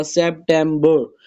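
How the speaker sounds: September split as sep, tem, ber; syllable by syllable. Shown as a demonstration. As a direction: give September three distinct beats. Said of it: "ये बर हो गया".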